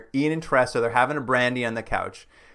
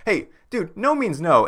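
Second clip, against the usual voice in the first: higher, louder, firm and scolding; firm mock rebuff; 7 semitones higher, 4 dB louder than usual. Landlady refusing the pushy naturalist, firm. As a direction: set him straight.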